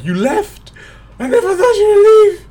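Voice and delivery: teacher wailing at a departed pupil, high hammed-up cry; upset teacher. Teacher hurt that the pupil left school, mock wailing. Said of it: "She's like".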